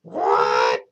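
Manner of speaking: low, loud, startled what; granny barks out her surprise; deep startled granny cry; shocked cry. Acted. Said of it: "cried Granny".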